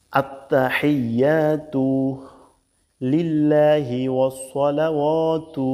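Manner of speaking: slow, careful Arabic recitation, each syllable drawn out. Shown as a demonstration. Teacher reciting Arabic slowly for learners. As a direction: recite it slowly for students to copy.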